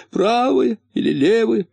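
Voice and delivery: high, plaintive acted question; sobbing question. A narrator's reading. Муфта presses his friend for hope, mildly sobbing. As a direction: ask it high, on the edge of tears. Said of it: "всхлипывая, спросил муфта".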